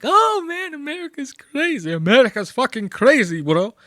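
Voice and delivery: mock bro voice. Comic mocking Ari trying to sound cool, high bro voice. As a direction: squeak it out like a try-hard frat guy.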